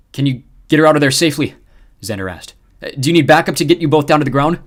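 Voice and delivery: loud, urgent questions. Acted. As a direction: press for an answer. Urgent question over comms.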